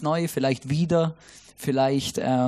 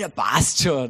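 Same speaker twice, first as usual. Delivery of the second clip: easygoing Austrian, no worries; Austrian shrug; 6 semitones lower, slower than usual. Retold or imitated. Someone in the Austrian mountains waving it off, laid-back Austrian. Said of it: "irgendjemand redet".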